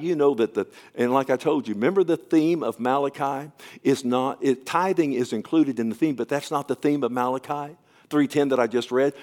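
normal talking voice

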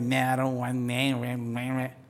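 slow, sulky pouting voice. Retold or imitated. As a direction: sulk it out like a stubborn kid. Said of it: "I can be like".

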